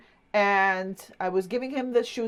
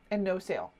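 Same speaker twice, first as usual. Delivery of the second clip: And no sale repeated softer and lower; soft echo; 3 semitones lower, 6 dB quieter, faster than usual. Real, not acted. Repeating the punchline to viewers, softer and lower. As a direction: let it drop, a rueful echo.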